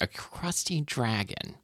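very soft, drawn-out musing; quiet, slow, as if trying on another mindset; different person pondering. Pretend. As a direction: mull it over slowly under the breath. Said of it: "try and be a different person".

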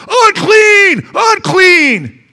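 high, loud warning cry; yelling. Acted. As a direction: holler it out so people keep their distance. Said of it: "They're used to yelling".

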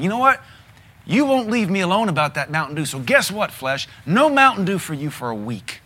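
loud, high mock telling-off; mock scolding own flesh. Preacher scolding his own flesh, hammed-up loud rebuke. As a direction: scold your cravings like a naughty child. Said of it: "get mad at your flesh sometimes and say".

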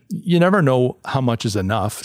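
normal talking voice

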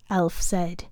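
ordinary delivery

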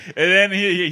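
usual speaking voice

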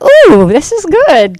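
high, slow, thrilled "ooh"; delighted. Retold or imitated. Speaker reacting to the odometer numbers, delighted and high. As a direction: savour the discovery with a lifted voice.

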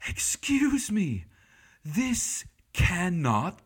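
put-on British accent, interrupting the room with a high, indignant call. British moderator bursting in, British accent, high and loud. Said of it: "with a great British voice".

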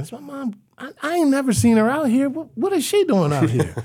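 high, loud stunned inner voice; can't believe his mom is here; shocked disbelief. Retold or imitated. Boy stunned to see his mom in that neighborhood, shocked high exclaim.

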